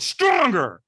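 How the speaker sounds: booming coaching shout; trainer's demanding shout. Retold or imitated. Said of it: "And he went".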